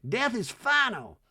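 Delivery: high, slow, ominous. Retold or imitated. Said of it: "Like, like".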